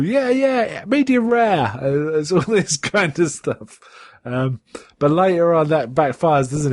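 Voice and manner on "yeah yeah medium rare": high, offhand mock reply; faking bad phone line. Reporter fobbing off his boss, mock offhand. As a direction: pretend the line is breaking up. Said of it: "pretend it's a terrible line".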